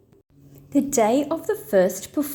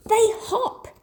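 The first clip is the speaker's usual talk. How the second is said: high little joey voice, matter-of-fact; a bossy kid brother correcting his sister; higher, cheeky kid brother; 11 semitones higher than usual. Acted.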